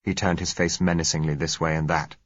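ordinary delivery, nothing marked

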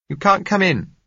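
calling through a door; woman's voice. A narrator's reading.

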